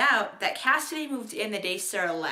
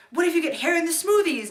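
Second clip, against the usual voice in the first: high, louder imitation of someone blurting a question; high-pitched mock voice; 8 semitones higher, 5 dB louder than usual. Acted quote of Josh's silly question.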